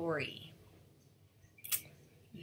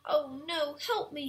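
high, louder storybook cry for help; distressed cry; 8 semitones higher, 4 dB louder than usual. A narrator's reading. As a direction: call out as a trapped character, mildly hammed-up. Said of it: "he cried".

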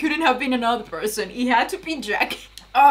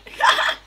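a sudden squeaky burst of laughter, high and breathy; odd high laugh; 4 dB louder than usual. Real, not acted. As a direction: let a strange high laugh escape. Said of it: "What was that laugh?".

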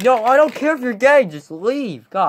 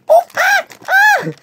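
shrieking high voice. Acted.

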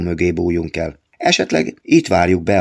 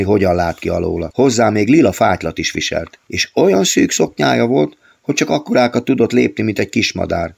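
usual speaking voice

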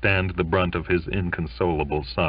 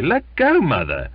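exasperated son; 10 semitones higher, 6 dB louder than usual. A narrator's reading. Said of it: "said Charlie".

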